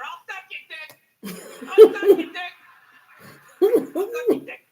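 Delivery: strung-out addict. Retold or imitated. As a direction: beg desperately, building to a wail. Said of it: "laying in, like, a gutter".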